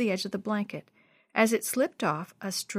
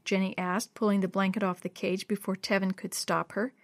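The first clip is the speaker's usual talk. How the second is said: plain reading voice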